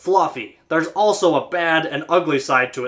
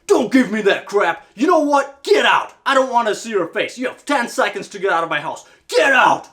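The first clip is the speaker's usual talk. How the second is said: imitating an angry shout, much higher; furious customer; 7 semitones higher than usual. Homeowner throwing the tech out of his house, furious shouting. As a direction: blow up like a livid homeowner. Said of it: "he was furious".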